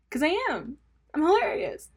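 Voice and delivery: cocky boast. Real, not acted. Boastful comic punchline.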